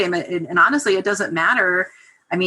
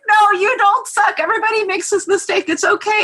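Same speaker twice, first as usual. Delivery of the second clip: reassuring; 14 semitones higher, 4 dB louder than usual. Retold or imitated. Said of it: "trying to reassure her".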